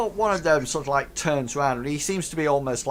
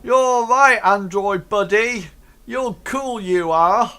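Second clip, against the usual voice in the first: high, loud ruffian voice; approving ruffian; 9 semitones higher, 9 dB louder than usual. Acted. Ruffians accepting the android buddy, high loud approval. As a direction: loudly welcome one into the gang. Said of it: "sort of say".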